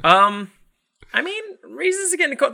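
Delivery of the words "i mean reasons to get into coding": high-pitched mock cheer.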